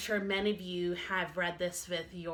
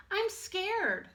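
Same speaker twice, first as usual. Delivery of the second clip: higher small cub voice; a tiny scared cub, pitched up; little bear cub; 10 semitones higher than usual. Acted. Little Bear tells Big Bear he is scared, high small voice.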